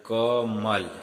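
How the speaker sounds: कमल said very slowly as one word; slow demo. Shown as a demonstration. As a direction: stretch out कमल so the class can hear it. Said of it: "इसको उच्चारण कीजिए".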